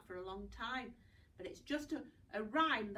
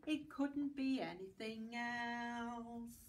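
slow; slower than usual. Shown as a demonstration. Rhyme closing line.

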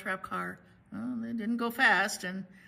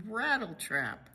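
ordinary delivery, nothing marked

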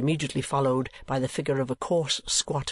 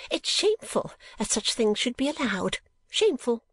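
put-on a scandalised old lady, very high; high shrill-free matron voice, much higher; Mrs Nickleby; 10 semitones higher than usual.

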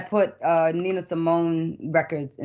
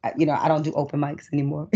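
usual speaking voice

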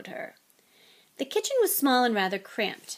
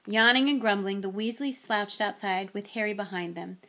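plain reading voice

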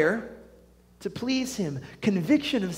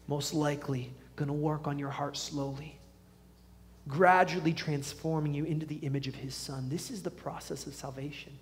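low, soft, measured sermon voice; sober preaching; 3 semitones lower, 6 dB quieter than usual. Real, not acted. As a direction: ease off the hype and level with them quietly.

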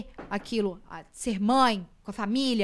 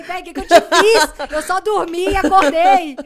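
loud, high, drawn-out mock complaint; indignant protest; 8 semitones higher, 15 dB louder, slower than usual. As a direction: protest as if deeply wronged. Retold protest at rejecting daughter. (protesto indignado, alto e exagerado)

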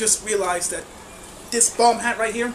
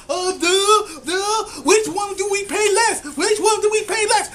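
dumb voice; 7 semitones higher than usual. Retold or imitated. Imitating confused patriarchy.

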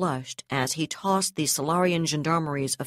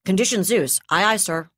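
louder snappy acknowledgment; crisp loud acknowledgment; 3 semitones higher, 6 dB louder than usual. Acted. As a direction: bark back the order confirmation. Officer acknowledges order loudly.

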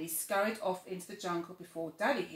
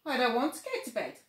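put-on higher, louder, fast child voice; a sulky little cub; little tiger; 5 semitones higher, 5 dB louder, faster than usual. First take, whispered per narration.

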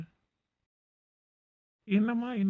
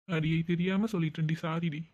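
normal talking voice

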